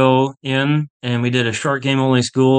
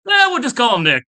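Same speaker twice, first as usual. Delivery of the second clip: casual director; 11 semitones higher than usual. Retold or imitated. Director of golf quoted.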